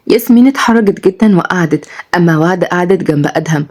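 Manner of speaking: ordinary delivery, nothing marked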